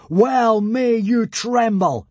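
slow, high, loud bellow; grand menacing roar. A narrator's reading. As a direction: bellow it like a wrathful god. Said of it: "bellowed Hiccup".

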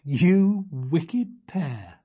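very slow, amused mock reproach; mother laughing off the mouse trick; fond mock scolding. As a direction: drag it out, scolding while smiling.

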